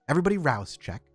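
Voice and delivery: ordinary delivery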